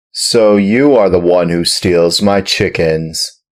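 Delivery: loud accusing farmer; angry farmer accusing. A narrator's reading. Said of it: "in an angry voice".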